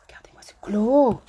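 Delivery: hushed whisper. Real, not acted. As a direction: breathe it out in a whisper. Showing glow.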